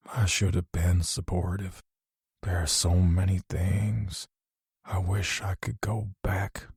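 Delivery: fading father making peace, hushed and tender; lightly voiced dying father. A narrator's reading.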